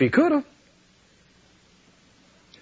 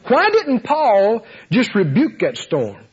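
ordinary delivery

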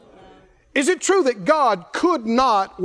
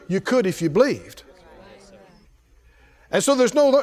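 usual speaking voice

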